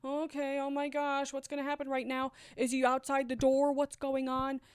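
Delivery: mildly panicked racing thoughts, held high; internal panic. Retold or imitated. Woman reliving panic while hiding it from family, anxious. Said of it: "having this internal panic".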